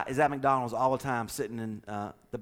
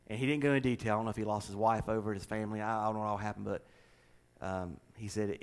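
normal talking voice

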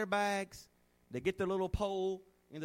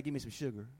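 sweet-talking husband; 7 semitones lower, 7 dB quieter, faster than usual. Retold or imitated. Husband asking wife for kiss.

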